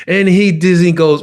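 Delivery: usual speaking voice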